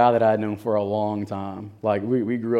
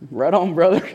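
higher, louder cheerful inmate; friendly inmate; 7 semitones higher, 5 dB louder than usual. Retold or imitated. Inmate congratulating his schoolmate, cheerful and louder. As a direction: give a buddy's approval.

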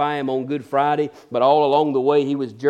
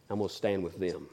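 quiet resolve; 6 semitones lower, 10 dB quieter than usual. Acted. Judas choosing to stand with the crowd, low and soft. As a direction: lower and softer, deliberate.

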